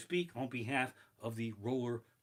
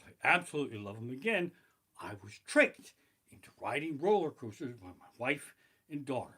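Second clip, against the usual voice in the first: normal talking voice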